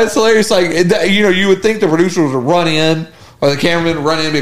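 ordinary talk

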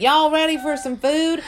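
high, loud Southern drawl; big, bright-pitched Southern twang; Southern accent. Shown as a demonstration. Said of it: "Southern is like".

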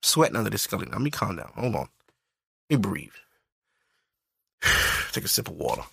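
quiet, low self-soothing after a rant; calming himself down. Real, not acted. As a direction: pull it back down and breathe. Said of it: "I'm getting upset".

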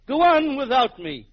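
high, loud, drawn-out heroic farewell; melodramatic plea. Acted. Exhausted man urging his friend to leave him, melodramatic plea. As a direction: cry it out slowly, hamming up the sacrifice.

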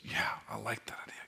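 breathy whisper of an inner voice. Retold or imitated. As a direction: whisper it like a private thought. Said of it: "something in me that just says".

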